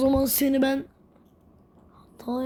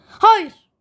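high, loud falling cry of no; anguished no; 9 semitones higher, 12 dB louder than usual. Real, not acted. Gamer watching the rope get cut, loud anguished cry. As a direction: wail it as the rope snaps.

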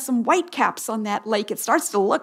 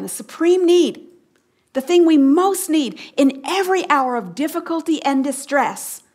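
higher, stressing "supreme need"; emphatic repeat; 3 semitones higher than usual. Shown as a demonstration. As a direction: say it again, leaning on "need". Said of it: "Let me say that again".

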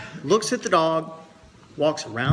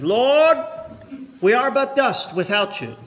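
louder and higher, in a pulpit voice; preaching pastor; 9 semitones higher, 6 dB louder than usual. Retold or imitated. Pastor's opening prayer in joke.

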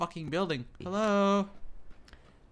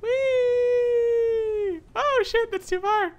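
very high, loud falsetto squeal; high-pitched whee turning to alarm; squealing glee; 5 dB louder, much slower than usual. Real, not acted. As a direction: squeal it like a wild ride. Skydiving in game, overshoots.

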